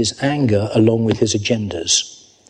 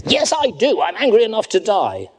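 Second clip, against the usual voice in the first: high, indignant protest as Jonah; sulky prophet talking back to God; Jonah's angry retort; 10 semitones higher than usual. Acted. Jonah talking back to God, indignant and high.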